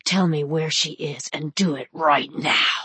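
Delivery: low menacing growl. A narrator's reading. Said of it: "voice so low ... growl".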